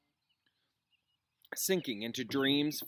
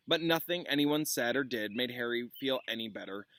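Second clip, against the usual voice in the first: ordinary delivery, nothing marked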